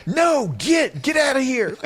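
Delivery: high mock shout, like shooing a dog; mock yell shooing a dog away. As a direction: shoo it off like a dog at a picnic. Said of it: "like he's yelling at a dog".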